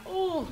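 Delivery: high shaky sigh holding back tears. Real, not acted. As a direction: let out a trembling sigh. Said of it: "I'm trying not to cry".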